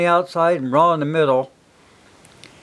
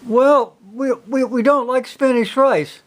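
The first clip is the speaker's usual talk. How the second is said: put-on timid kids; 7 semitones higher than usual. Kids' reply voiced by dad.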